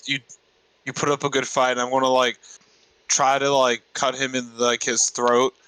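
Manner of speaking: usual speaking voice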